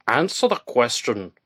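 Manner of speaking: slow, firm command with some force; stern order. Acted. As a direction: lay down the order, word by word. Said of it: "the man with the light ordered".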